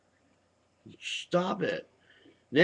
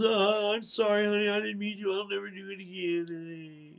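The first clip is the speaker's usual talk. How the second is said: high, syrupy fake apology from a wife beater; mock wife-beater apology; 6 semitones higher than usual. Husband to his beaten wife, mock sorry whine. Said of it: "he goes".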